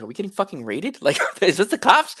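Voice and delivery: retold panic, pitch climbing into a laugh; retold alarm. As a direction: ask it in laughing disbelief. Retelling his startled reaction, laughing.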